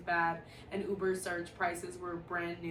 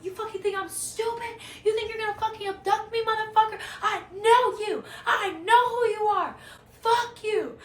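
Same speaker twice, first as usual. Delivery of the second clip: furious high screaming, much louder; cussing him out; 13 semitones higher, 7 dB louder than usual. Retold or imitated. Cussing out the fake Uber driver, furious screaming. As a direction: tear into the driver at full volume. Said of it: "full on cussing him out".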